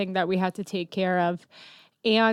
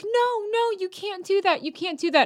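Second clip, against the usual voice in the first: imitating freaked-out waiters; 11 semitones higher than usual. Waiters objecting to weed on a tray, mock freak-out in a high voice. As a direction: mimic someone fussing in a much higher voice. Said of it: "waiters there were freaking out".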